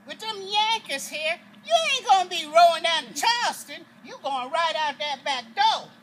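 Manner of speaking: a mother telling pa he's leaving by the back door; firm defiant order. Retold or imitated. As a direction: put your foot down for the family. Mother defies the master's plan.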